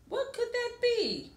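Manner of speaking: high, curious wondering; Amu wondering. Acted. Amu hearing knocks at her door, high curious wonder. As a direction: lift the whole question up high in surprise. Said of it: "Amu wondered".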